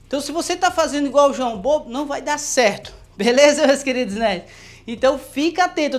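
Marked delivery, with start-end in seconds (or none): none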